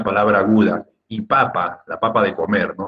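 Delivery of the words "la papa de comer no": ordinary talk, nothing marked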